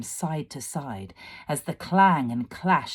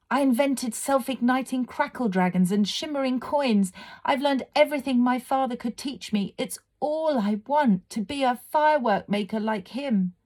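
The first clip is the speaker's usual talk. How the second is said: put-on high, fast, earnest girl's voice; desperate young girl; 9 semitones higher than usual. A narrator's reading. Leela begging the fire fiend to let her be a firework maker. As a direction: pour it out, begging to be heard.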